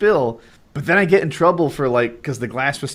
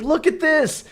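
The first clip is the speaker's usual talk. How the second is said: put-on kid showing hurt; 13 semitones higher, slower than usual. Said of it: "I'd be like".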